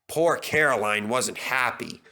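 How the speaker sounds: ordinary delivery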